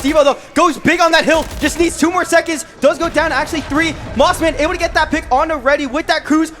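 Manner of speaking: high, urgent, building hype; excited play-by-play. Real, not acted. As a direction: call it as the clock runs out. Live play-by-play, final seconds.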